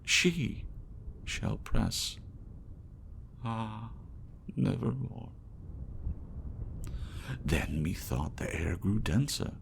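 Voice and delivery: theatrical recital with swelling passion and hushed ends; dramatic poem reading. Acted. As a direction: recite it like a stage performance. Reads The Raven aloud.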